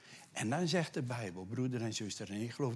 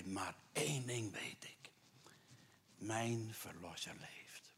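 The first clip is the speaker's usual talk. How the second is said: slow awed whisper; barely voiced, reverent whisper; whispered confession; 9 dB quieter, slower than usual. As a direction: let Job breathe his faith. Voicing Job's confession, hushed.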